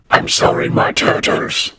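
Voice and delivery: slow, voiceless layered ghoul whisper; a possessed whisper, cold and hollow; ghastly layered voice. Acted. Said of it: "ghastly layered voice of Lorna".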